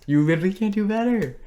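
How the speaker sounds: high-pitched incredulous rave; gushing praise. Real, not acted. Cohost raving about the casting, gushing praise. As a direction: push it up high in disbelief.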